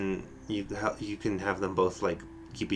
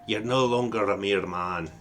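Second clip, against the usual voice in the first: a light Irish lilt, fatherly and grave; Irish accent. Acted. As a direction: speak as an Irish elder blessing the lad. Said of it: "how did I slip into an Irish accent".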